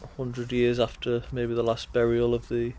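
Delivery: ordinary talk, nothing marked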